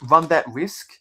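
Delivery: ordinary talk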